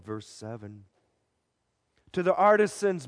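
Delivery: usual speaking voice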